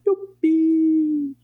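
squealed high cheer; a shrill little yay. Acted. Cheer at end of episode.

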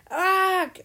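loud, high groan of dismay; groaning in chorus. Acted. Boys groan at the cancelled night disco, loud and high. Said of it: "riefen die Jungen im Chor".

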